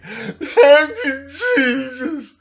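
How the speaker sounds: high, loud sobbing thanks; hammed-up crying praise; imitating a weeping man thanking Jesus; mock bawling prayer. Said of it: "on the ground crying and bawling".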